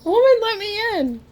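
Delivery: high, loud mock demand; dog's imagined voice. Dog to owner through the door, mock demanding voice. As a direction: voice an impatient dog demanding entry. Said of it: "He's like".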